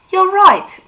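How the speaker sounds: high, loud mocking "You're right"; mock her with fake agreement. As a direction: Jace mocking the girl. Jace mocks Clary.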